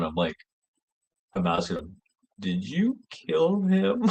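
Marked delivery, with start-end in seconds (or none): 2.4-4.0 slow, high-pitched retold accusation; incredulous suspicion. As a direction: draw the question out in mock disbelief. Retelling his suspicious question to Ron.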